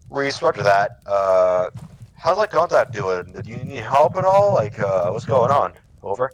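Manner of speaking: loud, casual, talkative radio reply; overly conversational radio reply; chatty wrong reply. Shown as a demonstration. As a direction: gab like a pal, not a radio operator. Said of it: "That's not how you do it".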